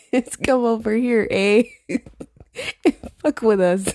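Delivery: lightly played Canadian, laughing through it; mock Canadian taunt. Host jokingly playing Canadians picking a fight, mock taunting.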